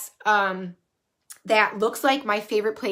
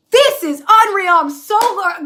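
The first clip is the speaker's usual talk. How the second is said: thrilled; 9 semitones higher, 8 dB louder, slower than usual. Retold or imitated. Thrilled reaction to the key box.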